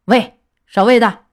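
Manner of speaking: loud call to the guards; shouted command. A narrator's reading. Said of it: "一声命令". (大声喝令)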